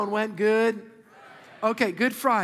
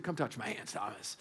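very low, soft aside to Thomas; hushed acted invitation; 12 semitones lower, 12 dB quieter than usual. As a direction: drop right down, as if coaxing a doubter. Acted Jesus gently inviting Thomas.

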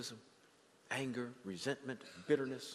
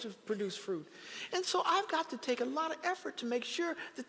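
preaching; 11 semitones higher, 3 dB louder than usual. Acted. Preacher to congregation about garden weeds, preaching. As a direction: lift it into a pulpit cry about weeds.

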